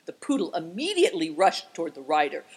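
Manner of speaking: plain reading voice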